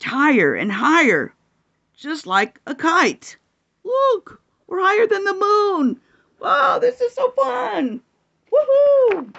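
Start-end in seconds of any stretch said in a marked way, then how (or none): 3.8-9.3 high, breathless joy; thrilled squeal. Acted. Narrator flying above the moon, thrilled. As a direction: marvel at how high you fly.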